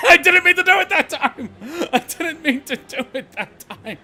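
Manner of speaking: high-pitched protest through real laughter; laughing protest. Real, not acted. Player excuses a slip to viewers, laughing protest. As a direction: crack up while insisting it was an accident.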